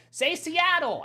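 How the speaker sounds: high, loud mock heckle; impatient listeners. Host mimicking listeners demanding the word, high impatient shout. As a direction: call it out like an impatient crowd. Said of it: "Everybody who's sitting here going".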